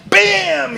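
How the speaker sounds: sudden high shouted bam; loud bam. Retold or imitated. Teller acting out the cowboy crashing the door, high shouted bam. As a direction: slam the word like a door crash.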